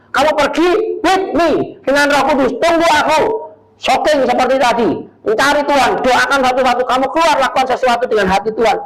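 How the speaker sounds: preaching at full force to the church; fired-up preaching. Acted. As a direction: preach it out with urgency. Preacher urging the congregation.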